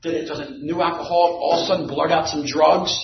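normal talking voice

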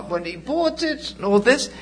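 usual speaking voice

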